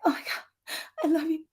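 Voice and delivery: breathy, near-tearful gasp into the words; mock crying. Shown as a demonstration. Coach shows how the breath sounds like crying, mock tearful. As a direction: imitate someone catching their breath in tears. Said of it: "sounds like she's crying".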